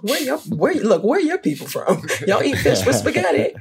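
stumbling, laughing disbelief; playful disbelief. Real, not acted. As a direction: question them in mock shock, laughter creeping in. Playful disbelief at fish with spaghetti.

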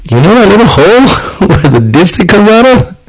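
high-pitched answer breaking into laughter; laughing retold quote. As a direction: pitch it way up, laughing mid-line. Retold answer to dad, cracking up.

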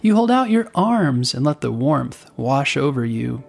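plain reading voice

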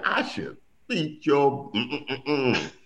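ordinary talk, nothing marked

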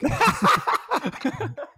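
high burst of laughter; real laugh. Real, not acted. Listener amused by the storyteller's accent, real high laugh. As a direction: crack up laughing.

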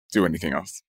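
usual speaking voice

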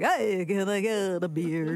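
low, slow garbled-talker voice; jokey slowed-down drone; mock backwards talk. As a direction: drag it out low, like reversed speech. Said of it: "gonna talk like that all the time".

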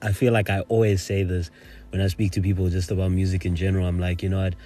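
ordinary delivery

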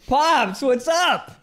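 loud, high happy hail; excited greeting. Real, not acted. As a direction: call out to a friend's dad. Greets friend's dad in chat.

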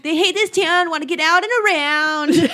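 High School Musical. Pretend. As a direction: belt it out like a teen musical number, only a hint. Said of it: "That turned a little High School Musical".

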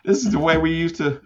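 high, creaky grandpa bit; old man voice. Pretend. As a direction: play a cranky old-timer. Joking about being old.

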